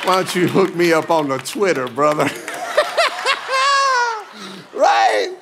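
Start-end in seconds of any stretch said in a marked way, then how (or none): 2.4-4.7 high bursting laugh; real amused laugh; hearty laugh. Real, not acted. Laughing at own story.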